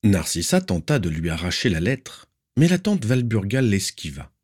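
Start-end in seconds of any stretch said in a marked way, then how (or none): none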